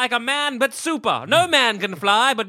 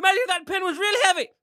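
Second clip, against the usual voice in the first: high fast pitch voice; 10 semitones higher, much faster than usual. Pretend. Mock pitch continues.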